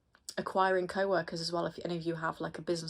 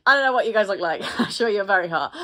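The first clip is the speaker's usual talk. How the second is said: laughing through the words, louder; real laughter, louder and quick; 3 semitones higher, 10 dB louder than usual. Real, not acted. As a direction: giggle as you tease the viewers. Laughing at her own joke.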